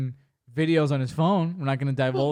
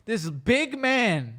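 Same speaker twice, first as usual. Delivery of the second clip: gushing compliment; 8 semitones higher, slower than usual. Retold or imitated. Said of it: "I'm like".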